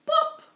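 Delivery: high loud pop. Acted. Button pops off.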